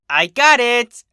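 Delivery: high, loud triumphant filly cry; proud exclamation. A narrator's reading. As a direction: shout it out, thrilled at the find. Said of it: "she exclaimed proudly".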